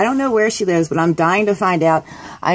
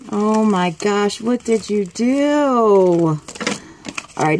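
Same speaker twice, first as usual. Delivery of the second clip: ordinary talk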